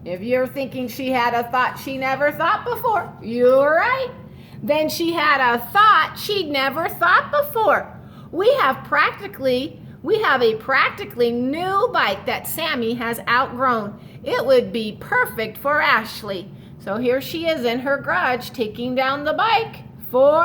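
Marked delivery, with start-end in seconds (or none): 4.6-16.5 storybook read-aloud to the class; reading to children. A narrator's reading. Teacher reading a picture book to her class, storytelling voice.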